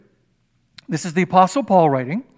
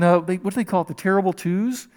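ordinary talk, nothing marked

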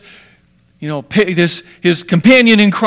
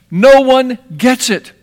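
emphatic slow exclamation; 5 semitones higher, 5 dB louder, slower than usual. Real, not acted. Preacher building emphasis.

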